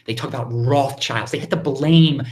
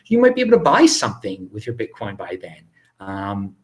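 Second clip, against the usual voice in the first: ordinary delivery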